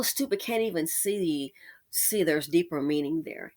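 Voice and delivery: breathy whisper, almost to herself; whispered aside. Real, not acted. Telling viewers the ad's hidden meaning, whispered. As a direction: whisper it like a secret.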